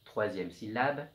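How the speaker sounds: ordinary talk, nothing marked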